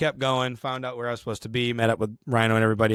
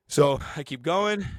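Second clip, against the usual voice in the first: normal talking voice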